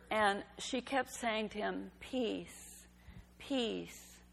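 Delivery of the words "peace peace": softly repeated, each word falling and set apart; prayer-like. Retold or imitated. Tired mother to her screaming son, soft prayer-like repetition. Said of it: "like a prayer".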